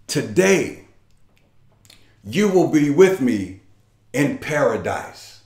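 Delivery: tender promise. Acted. Jesus promise voiced softly.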